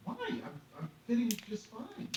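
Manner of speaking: high, loud disbelieving protest; indignant protest. Retold or imitated. Passenger refusing to leave seat, indignant. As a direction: object with voice jumping way up. Acted protest at being removed.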